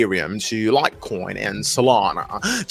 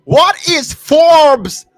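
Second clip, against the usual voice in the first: incredulous outcry; 13 semitones higher, 9 dB louder, slower than usual. Real, not acted. Baffled by the article text.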